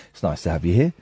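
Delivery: a hint of a bored colleague; mock office filler. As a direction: toss it off like a bored coworker. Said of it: "you just turn and go".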